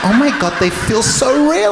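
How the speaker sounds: friend marvelling at the boobs, high and gushing; amazed gushing. Retold or imitated. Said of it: "She goes".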